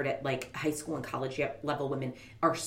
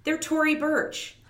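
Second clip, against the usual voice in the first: indignant brand defense; 11 semitones higher, 4 dB louder, slower than usual. Retold or imitated. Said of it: "And she's like".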